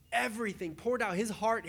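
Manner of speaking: usual speaking voice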